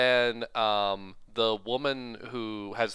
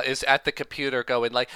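normal talking voice

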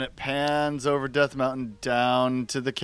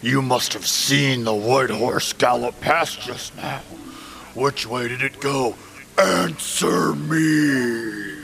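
louder, menacing villain demand; 4 dB louder than usual. Acted. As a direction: loom over the kid, threatening. Villain voice quote.

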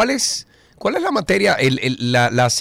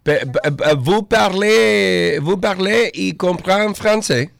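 slow, stumbling put-on French question; halting mock French; 8 semitones higher, slower than usual. Host tests the girl's French with slow, stumbling mock French. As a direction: drag out the French words like a shaky tourist.

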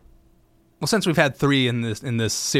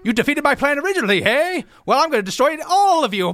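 mustache twirling villain; 12 semitones higher than usual. Retold or imitated. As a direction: gloat like a cartoon baddie. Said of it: "he was basically mustache twirling".

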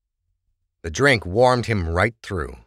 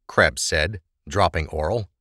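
usual speaking voice

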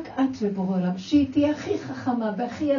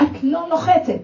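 louder, higher mock yell; a mother in labour yells the line; retold yell; 7 semitones higher, 6 dB louder than usual. A mother in labour yells at the midwife, a retold yell. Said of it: "שהיולדת צועקת".

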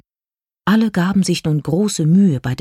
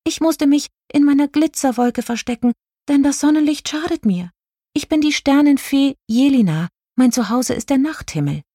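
high-pitched, earnest fairy voice; little star fairy explaining; 10 semitones higher than usual. A narrator's reading. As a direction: explain yourself in a small high voice.

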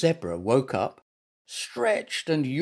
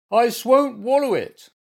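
high, loud refusal; squealing; 11 semitones higher, 7 dB louder than usual. A narrator's reading. Bear refuses his medicine, high loud squeal. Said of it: "squealed Goompa".

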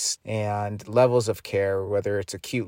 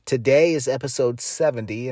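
upbeat podcast host; 4 semitones higher, 3 dB louder than usual. Acted. Host's upbeat show open.

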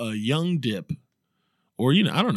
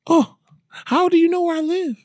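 much higher, giggly swoon; swooning woman; 4 dB louder than usual. Acted. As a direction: answer like a flattered lady.

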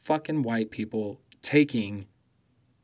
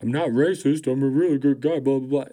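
mock defensive whine; 4 dB louder, faster than usual. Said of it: "I'm like".

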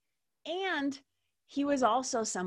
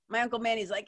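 usual speaking voice

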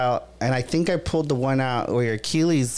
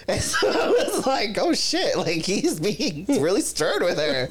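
much higher, laughing through words; 5 semitones higher, slower than usual. Real, not acted. Telling the friend about the stern shush, laughing. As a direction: giggle through it.

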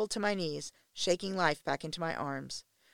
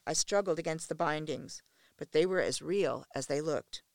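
ordinary delivery

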